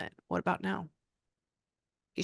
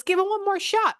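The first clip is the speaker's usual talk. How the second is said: high-pitched, louder quoted urging; urgent relayed plea; 5 dB louder than usual. Retold or imitated. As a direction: relay it as a high, insistent cheer.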